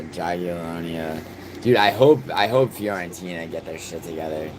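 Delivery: ordinary delivery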